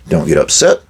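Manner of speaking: ordinary talk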